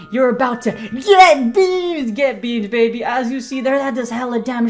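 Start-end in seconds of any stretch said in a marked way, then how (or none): 0.1-3.0 drawn-out hype yell, louder; hype shout. Real, not acted. Caster hyping his big attack with a drawn-out yell. Hyped hype call in game.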